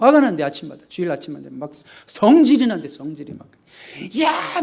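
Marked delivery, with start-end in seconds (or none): 4.2-4.6 put-on sudden high shout at the kids; an exasperated mom shouting across the house; mother's yell. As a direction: yell at the children to hurry. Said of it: "막 소리를 지르고".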